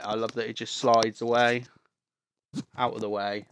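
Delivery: ordinary talk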